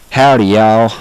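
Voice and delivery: loud, drawn-out mock-Southern greeting; hammed-up cowboy Southern accent. Shown as a demonstration. Imitating people's bad Southern impersonation, exaggerated Southern drawl. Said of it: "use howdy in their, um, accent, uh, impersonation".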